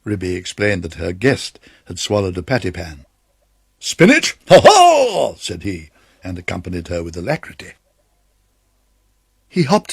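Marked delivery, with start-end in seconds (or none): 3.8-5.4 magpie doctor. Acted.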